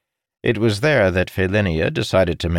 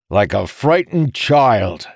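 slow, louder, mocking; slow taunt; 5 semitones higher, 5 dB louder, slower than usual. A narrator's reading. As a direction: drag out the insult to sting. Morgidian keeps taunting Jacob.

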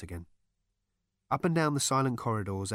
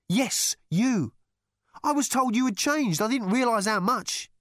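loud, high accusation; exasperated rebuke; 13 semitones higher, 6 dB louder than usual. A narrator's reading. Rooster tells Zaphod he has changed, exasperated. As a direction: scold him with raised pitch.

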